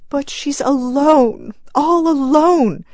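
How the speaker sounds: grieving mother insisting her dead daughter is all alone; choked. Acted. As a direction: cry it out through a tight throat. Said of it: "choked the woman".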